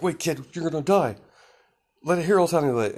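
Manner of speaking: mock hero warning. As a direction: call out to stop the kid. Hero warns Izuku.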